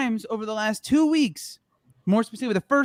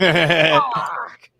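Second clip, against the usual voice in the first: long, louder gruff British curse; Roy Kent; 5 dB louder than usual. Acted. Repeating the approved impression for friends, gruff swearing. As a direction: stretch out the hardman's growled swear. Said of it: "was a Roy Kent impression".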